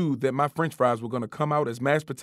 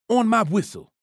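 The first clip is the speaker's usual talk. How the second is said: coach voice; 8 semitones higher, 4 dB louder than usual. A narrator's reading. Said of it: "Coach said".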